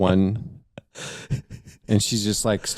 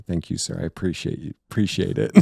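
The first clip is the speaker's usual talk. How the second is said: meek thanks; 6 semitones lower, 10 dB quieter, faster than usual. Retold or imitated. Polite thanks to officer.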